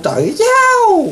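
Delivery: a sudden high, slow squeal of scorn; mocking exclamation. Real, not acted. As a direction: stretch it out high in mock dismay.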